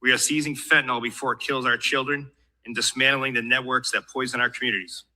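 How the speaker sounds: normal talking voice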